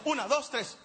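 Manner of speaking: cuenta "Una, dos, tres" de corrido, sin pausas; conteo rápido. Shown as a demonstration. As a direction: vuelve a contar "Una, dos, tres" seguido y con ritmo. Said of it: "No le salió". ("Una, dos, tres" dicho rápido de un tirón)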